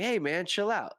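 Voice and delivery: lightly imitated high-pitched boxer voice; a hint of high voice, asking for calm; Mike Tyson. [name] to the offended stranger, light Mike Tyson voice. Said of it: "in his Mike Tyson voice".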